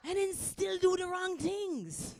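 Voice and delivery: high, slow Jamaican dad impression; Jamaican accent. Dad complaining about his son, exasperated Jamaican accent. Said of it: "This is Jamaican accent".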